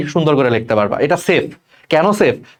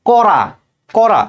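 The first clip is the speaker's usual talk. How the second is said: example word; 6 semitones higher, much slower than usual. Shown as a demonstration. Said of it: "যেমন তুমি যদি আমাকে বলো".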